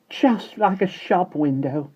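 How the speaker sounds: high, slow admiring housemaid; housemaid's voice. A narrator's reading. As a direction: coo it like a prim maid admiring a shop window. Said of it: "as Ellen the housemaid said".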